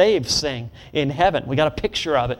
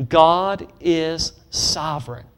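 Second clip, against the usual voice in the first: God is sovereign, slow with weight on God; slow emphatic declaration; slower than usual. Shown as a demonstration. Preacher modeling the line for the congregation, slow and emphatic. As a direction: lay heavy stress on God, slowly. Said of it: "Can you say that with me?".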